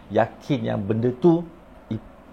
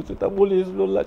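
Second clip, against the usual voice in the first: higher, tearful imitation of the boy; imitating crying; 9 semitones higher than usual. Crying boy pleading with his teacher, imitated tearfully. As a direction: mimic a sobbing child pleading. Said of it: "Dia terus nangis tau".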